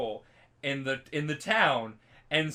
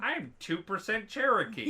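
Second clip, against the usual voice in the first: lightly mocking, higher boastful voice; mimicked bragging; 7 semitones higher than usual. Mocking people who claim tiny ancestry, mimicked bragging. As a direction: pipe up like someone claiming exotic ancestry.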